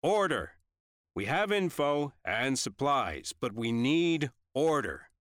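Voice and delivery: high, slow commanding shout; loud shout. A narrator's reading. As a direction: shout a crowd into line, drawing the words out. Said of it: "He shouted it even louder".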